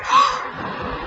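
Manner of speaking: big theatrical gasp; mock gasp. Teacher reading to nursery children, hammed-up gasp. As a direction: gasp in pretend shock. Dramatic gasp after knees.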